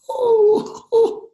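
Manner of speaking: very high, loud delighted "oh"; overjoyed gasp. A narrator's reading. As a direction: whoop with joy at the news. Father hears of four babies.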